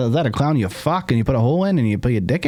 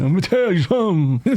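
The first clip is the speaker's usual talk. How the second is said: Bernie impression; 7 semitones higher than usual. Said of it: "I fall into [name]".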